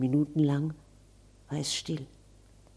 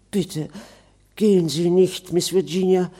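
a louder plea, calling after her; ghost calling out; 3 semitones higher, 7 dB louder than usual. Acted. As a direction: stop her from leaving. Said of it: "rief das Gespenst". (rufendes Gespenst, flehend)

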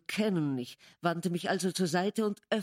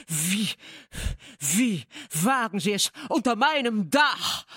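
louder, higher furious challenge; thundering; 4 semitones higher, 6 dB louder than usual. A narrator's reading. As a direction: keep roaring at the guest. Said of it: "donnerte Heathcliff".

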